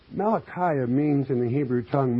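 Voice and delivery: normal talking voice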